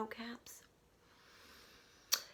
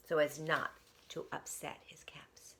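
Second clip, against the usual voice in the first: careful hushed whisper; 4 semitones lower, 9 dB quieter than usual. A narrator's reading. Storyteller whispers about the careful peddler. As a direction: tiptoe the words in a whisper.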